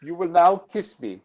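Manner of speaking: mock German officer's command; German accent. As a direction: imitate a Gestapo man's German accent. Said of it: "English in a German accent".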